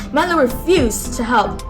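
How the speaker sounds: ordinary delivery, nothing marked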